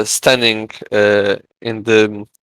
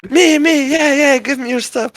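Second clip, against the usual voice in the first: a crowd squealing, much higher, to be picked; berserk greedy crowd. Retold or imitated. Players beg to get the armor, in a high, frantic squeal. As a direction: kids clamouring for free armor. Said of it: "they would just go berserk".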